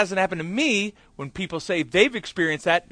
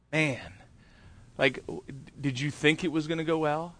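a quiet sneer of self-doubt; low, quiet inner critic taunting the pastor; mocking inner voice; 3 semitones lower, 9 dB quieter than usual. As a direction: mutter it like the nagging voice in your head. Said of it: "I'm hearing the voice".

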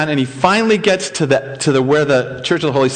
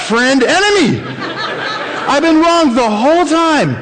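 the penny drops, half laughing; stunned realisation; 9 semitones higher than usual. Retold or imitated. Adam realising the enemy was his friend, amazed. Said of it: "Adam's like".

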